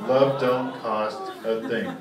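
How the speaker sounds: slow, low mock-heartfelt repeat; mock sincere. Comic repeating Harry's fake moral, slow and syrupy. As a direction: drag out the sappy moral.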